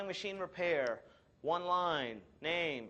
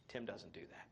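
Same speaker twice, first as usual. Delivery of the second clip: whispered aside; 5 semitones lower, 14 dB quieter than usual. Real, not acted. Sly aside about himself.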